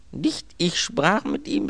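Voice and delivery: high, slow, emphatic protest; exasperated correction. Acted. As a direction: set the servant straight, upset.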